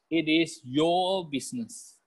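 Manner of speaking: slow, drawn-out "your"; deliberate delivery, "your" held long; stress on YOUR. Shown as a demonstration. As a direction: stretch "your" to drive ownership home. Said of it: "Now, remember this".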